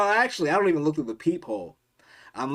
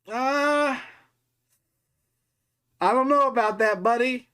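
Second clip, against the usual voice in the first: much higher, louder hesitant voice; nervous refusal; 13 semitones higher, 7 dB louder than usual. Retold or imitated. Refusing the intruder through the door, high and uneasy. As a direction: back out of it politely but scared.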